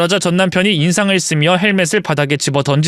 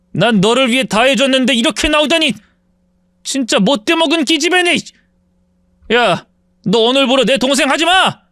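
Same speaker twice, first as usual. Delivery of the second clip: wounded, angry brother, raised pitch; indignant older brother; 8 semitones higher than usual. Acted. Brother disowning his sister after all he gave, indignant. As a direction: disown her in anger.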